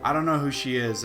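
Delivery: ordinary talk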